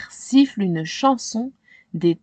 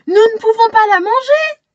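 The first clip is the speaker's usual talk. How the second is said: put-on high, loud, fast girl's protest; girl crying out; 12 semitones higher, 7 dB louder, faster than usual. Shayna protests to her family, high and loud. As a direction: cry out to save the hen. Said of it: "s'écrie Shayna".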